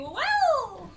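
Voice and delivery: high mock scream; high-pitched yelled impression; imitated scream. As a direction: mimic someone suddenly screaming. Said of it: "I hear him screaming".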